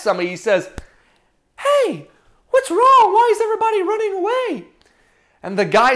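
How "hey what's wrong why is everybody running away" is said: put-on high, boyish questioning voice; quick, high-pitched puzzled lad; young David. Young shepherd asking why soldiers flee, high puzzled voice. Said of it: "David sees all these people".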